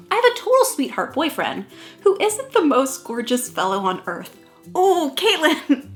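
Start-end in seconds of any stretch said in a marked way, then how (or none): 4.7-5.9 slow, drawn-out cringing groan of her name; exasperated. Real, not acted. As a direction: groan at your younger self.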